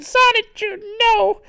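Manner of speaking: very high, loud damsel cry; hammed-up squeal of distress; Rose Chew. Rose Chew cries out to Sonichu, high and alarmed. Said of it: "And she's like".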